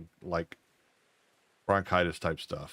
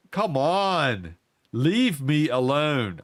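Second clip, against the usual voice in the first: higher, louder, fed-up plea to the ghost; exasperated plea; 6 semitones higher, 4 dB louder, much slower than usual. Retold or imitated. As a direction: beg it to stop, annoyed. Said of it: "Now I'm getting pissed".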